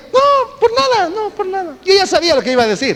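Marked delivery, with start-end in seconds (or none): 0.1-1.8 high, sheepish brush-off, a laugh in it. Retold or imitated. Patient brushing off the doctor's question, high and sheepish. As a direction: act like nothing happened, squeaky high. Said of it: "reply to doctor".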